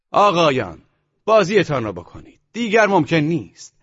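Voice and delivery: croupier's loud call. A narrator's reading. Croupier calling players to bet, loud announcing voice. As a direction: call the bets out loud across the room. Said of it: "به صدای بلند".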